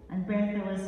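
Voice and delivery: dramatic poem with character voices; spoken word performance. Acted. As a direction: perform it to the room with rising energy.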